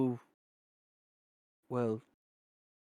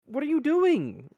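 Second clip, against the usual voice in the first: bewildered woman; 8 dB louder than usual. Acted. NPC bewildered by questions.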